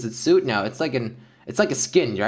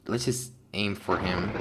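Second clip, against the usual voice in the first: normal talking voice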